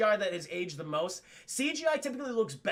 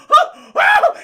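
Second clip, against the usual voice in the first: two very loud, high shrieks; mock panicked yelps; 18 dB louder than usual. Acting out Gennaro losing it at the T-Rex, panicked yelps. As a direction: yelp like a man losing it. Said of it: "he loses his shit".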